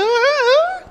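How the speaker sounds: high squeaky stammer; cornered man squeaking to the building manager; voice breaking. Acted. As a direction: let the voice crack up high. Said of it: "eu virei um salsicha".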